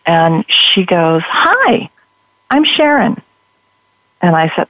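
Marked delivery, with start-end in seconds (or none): 1.2-3.2 much higher, sing-song welcome; imitating a beaming booth volunteer; bright cheery greeter. Greeting a newcomer at the pink booth, imitated bright high welcome.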